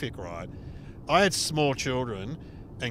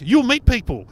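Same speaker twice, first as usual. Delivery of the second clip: chirpy host; 9 semitones higher, 10 dB louder than usual. Retold or imitated. Host cheerily reassuring.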